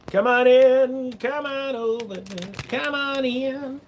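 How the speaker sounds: high, very slow goofy voice; silly put-on voice. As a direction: stretch each word out high and slow. Same silly voice inviting viewers.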